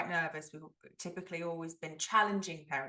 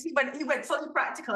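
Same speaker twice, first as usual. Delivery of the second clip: ordinary talk, nothing marked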